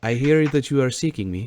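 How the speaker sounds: put-on loud, formal accented stranger; Giuseppe. Speaking through a wall to seekers, loud accented voice. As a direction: announce yourself in a put-on accent. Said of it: "and he says".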